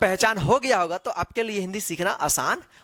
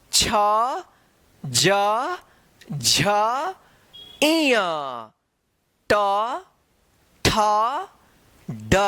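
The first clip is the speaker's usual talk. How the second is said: slow, drawn-out reciting of letters; letter recitation; much slower than usual. Shown as a demonstration. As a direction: call out each letter clearly for learners. Recites the consonant alphabet. (अक्षरों का धीमा उच्चारण)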